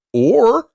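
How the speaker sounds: "or" punched loud and high; retold dad's loud, high "or" standing alone; dramatic "or". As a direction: hit "or" hard as the big alternative. Dad setting up the alternative.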